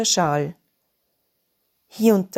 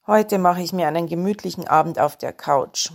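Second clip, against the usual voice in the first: ordinary delivery, nothing marked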